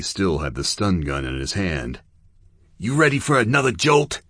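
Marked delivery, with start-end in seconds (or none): 2.8-4.2 higher, louder tense threat; a tense, angry taunt to the captive. Acted. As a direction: snarl it at the man on the floor. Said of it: "super tense and angry".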